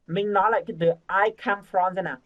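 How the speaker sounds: ordinary delivery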